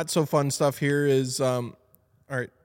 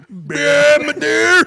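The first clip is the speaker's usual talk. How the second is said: loud, high deer bleat; deer grunts; 13 semitones higher, 11 dB louder, much slower than usual. Pretend.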